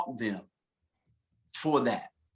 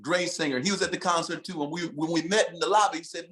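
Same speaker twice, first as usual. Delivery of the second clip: normal talking voice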